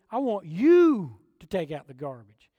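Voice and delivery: loud, pointed parent ordering; stern parent. Retold or imitated. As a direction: bark chores at the kids. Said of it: "You ever done this to your kids?".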